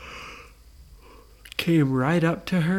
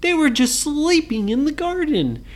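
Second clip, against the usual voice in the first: mock amazement; 11 semitones higher than usual. A narrator's reading. Punchline for listening children.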